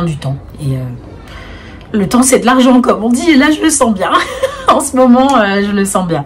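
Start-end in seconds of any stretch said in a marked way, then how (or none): 1.9-4.1 words bubbling with laughter, higher and louder; laughing. Real, not acted. Joking to viewers about money, laughing. 4.1-4.6 short high-pitched laugh; high giggle. Real, not acted. Amused at her own money joke, a high giggle. 4.7-6.2 laughing. Real, not acted. Still laughing.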